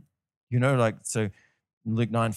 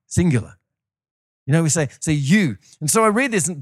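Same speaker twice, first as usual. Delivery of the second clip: ordinary talk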